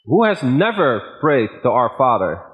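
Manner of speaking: ordinary talk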